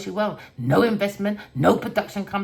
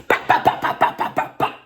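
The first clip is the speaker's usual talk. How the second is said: fighting noise; 4 dB louder than usual. Retold or imitated. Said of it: "I'm fighting it".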